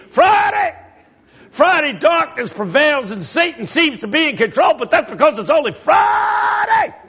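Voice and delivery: full-force preacher. Retold or imitated. Pastor defies Satan before his church, high roaring refrain. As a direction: imitate a revival preacher climbing to a yell.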